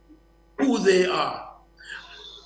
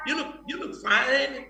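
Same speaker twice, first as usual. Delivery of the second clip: a soft, higher sweet-talking come-on; mock pickup line; 4 semitones higher, 6 dB quieter than usual. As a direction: lightly play a smooth-talking guy chatting up a girl. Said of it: "the first man had said".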